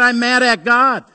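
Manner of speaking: woman's angry retort, voiced loud; retold outburst. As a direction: fling out her words like she's still angry. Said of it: "She said".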